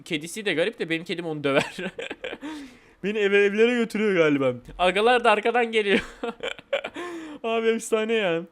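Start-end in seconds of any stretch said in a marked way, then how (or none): 1.8-2.6 quiet, higher-pitched chuckle; laughing. Real, not acted. Player amused by own cat joke, soft laughter. 6.1-7.4 soft, high laughter; laughing. Real, not acted. Player amused at friends following behind, soft high laughter.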